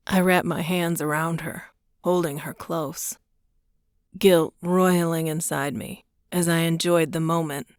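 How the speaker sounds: usual speaking voice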